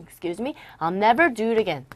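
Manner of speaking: ordinary talk, nothing marked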